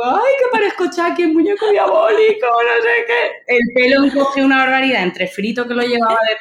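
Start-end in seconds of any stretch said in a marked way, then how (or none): 0.0-3.5 high wailing cry, half-joking; shouted mock despair, much higher. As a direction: wail it like a tearful kid who hates her haircut. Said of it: "empecé a llorar".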